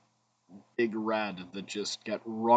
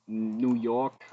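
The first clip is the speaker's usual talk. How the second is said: put-on failed New York accent; 4 dB louder than usual. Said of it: "I can't do a New York accent".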